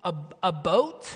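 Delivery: questioning repeat of the Wisconsin vowel; baffled mimicry of a Wisconsin long o; puzzled echo of Wisconsin accent. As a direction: hesitantly copy the Wisconsin "a boat". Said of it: "And I'm like".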